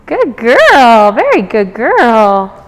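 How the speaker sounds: warm, drawn-out dog praise; loud sweet praise for a good dog. Real, not acted. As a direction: coo it to the dog. Praising dog during training.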